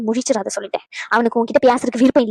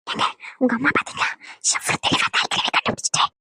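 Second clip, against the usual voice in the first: whisper; 2 semitones lower, 5 dB quieter than usual. Acted. Aside to husband, secretly.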